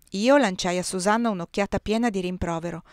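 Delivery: usual speaking voice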